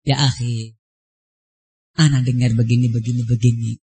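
very low, soft quoted counsel; gentle admonition. Retold or imitated. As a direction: imitate a patient teacher gently taking a brother aside. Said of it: "Dinasihatin dengan lembut".

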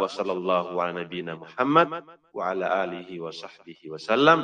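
ordinary talk

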